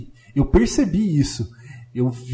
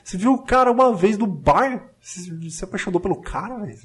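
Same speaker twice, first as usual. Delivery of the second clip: louder, higher incredulous scolding; mock scolding; 5 semitones higher, 5 dB louder than usual. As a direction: scold her like a friend making a bad choice. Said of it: "scolding the character".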